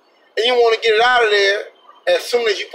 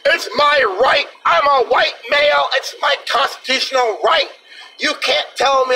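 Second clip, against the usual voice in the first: high, mock-indignant protest; mock entitled gunman; 6 semitones higher than usual. Entitled man lectures police about his rights, high and indignant. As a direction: whine it like an entitled gun carrier. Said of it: "the police like".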